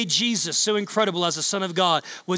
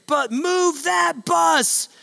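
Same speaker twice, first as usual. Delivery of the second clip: stretched-out, high announcer call; Ty Pennington; 10 semitones higher, much slower than usual. Retold or imitated. Host calling to the crew to reveal the house, drawn out high. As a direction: draw it out like a TV host's big reveal. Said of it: "Ty Pennington would say it".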